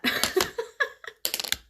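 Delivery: a squeaky self-conscious laugh; breathy high giggle; high embarrassed giggle. Real, not acted. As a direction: giggle at yourself, caught out. Laughs at herself.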